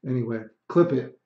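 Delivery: normal talking voice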